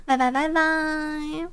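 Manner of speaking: slow, drawn-out byes; singsong goodbye. Real, not acted. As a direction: wave them off slowly.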